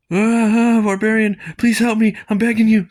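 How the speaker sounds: hammed-up frantic cry to the barbarian; begging shout. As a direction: scream for rescue, pleading. Victim begs barbarian for help.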